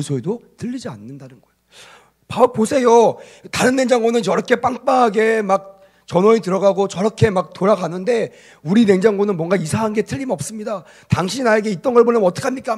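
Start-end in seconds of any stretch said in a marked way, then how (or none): 2.3-12.8 loud, high customer tirade, re-enacted; acted angry yelling. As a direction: play a customer shouting down the phone in anger. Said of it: "막 소리를 막 질렀다는 거예요". (버럭 소리치며 따지는 고객 연기)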